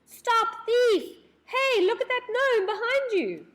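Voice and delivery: high, loud alarm shout; shouting loudly. A narrator's reading. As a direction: yell to warn the elves of the thief. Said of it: "He shouted loudly".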